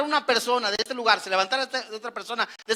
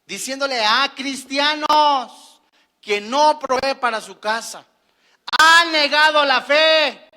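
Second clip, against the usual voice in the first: fiery preaching; 4 semitones higher, 8 dB louder than usual. Acted. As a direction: hammer each phrase from the pulpit. Preaching at full force.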